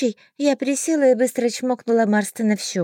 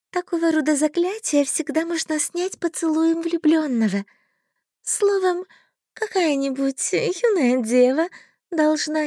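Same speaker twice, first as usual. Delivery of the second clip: high, coy fairy voice; blushing little fairy; 6 semitones higher than usual. A narrator's reading.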